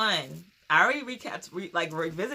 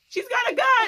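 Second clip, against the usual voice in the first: high, loud alarm call with laughter in it; mock panicked yell, pitched way up; retold yell; 8 dB louder than usual. Retelling someone yelling at the event, mock alarmed yell. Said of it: "and then someone yelled".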